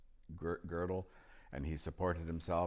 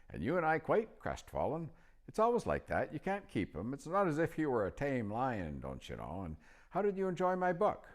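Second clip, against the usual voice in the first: put-on higher, louder old wizard voice; old magician; 9 semitones higher, 5 dB louder than usual. A narrator's reading. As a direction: pitch up into the chatty old magician. Narrator voicing old magician.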